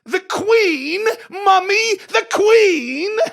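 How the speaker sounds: very high, very loud mock-regal cry; hammed-up shriek, vowels held long; indignant royal retort. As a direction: belt it out as an affronted queen to her mother. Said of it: "It sounds better in a British accent".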